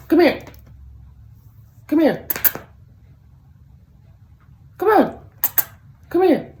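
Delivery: high, bright sing-song calls; coaxing the dog. Real, not acted. Owner coaxing her Chihuahua to come over.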